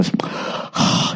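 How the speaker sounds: imitated long sigh of boredom. Imitating a beautiful woman bored all day, bored sigh. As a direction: let out a mock bored sigh. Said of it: "sigh just out of boredom".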